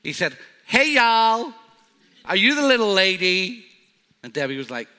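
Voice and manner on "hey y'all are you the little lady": loud, high hammed-up American drawl; American accent. Preacher imitating an American fan in an American accent. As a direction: put on a big friendly American accent, badly. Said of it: "terrible with accents, but I'll try".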